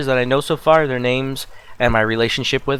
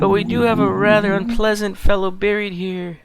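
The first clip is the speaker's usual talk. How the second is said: a hammed-up lonely ghost, lightly voiced; exaggerated ghost voice; 7 semitones higher, slower than usual. Ghost Yorick greeting the new graveyard keeper, exaggerated ghost voice. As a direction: ham up the graveyard ghost, mostly in your own voice. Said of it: "exaggerating that ghost, uh, that ghost voice".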